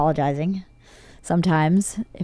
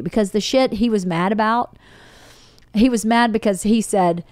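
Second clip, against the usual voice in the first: ordinary talk, nothing marked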